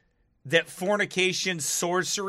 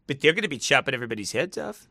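ordinary talk

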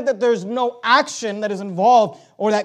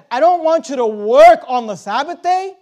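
preacher quoting God, leaning on work; stress on work; 3 semitones higher, 6 dB louder than usual. Shown as a demonstration. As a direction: work punched louder. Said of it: "put the emphasis where God places the emphasis".

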